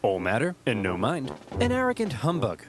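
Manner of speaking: ordinary talk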